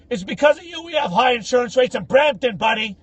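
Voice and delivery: high, loud heckle from a car; retold yell. Guy at his car window blaming him, imitated yelling. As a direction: yell it out like an irate driver. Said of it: "looks at me and yells out".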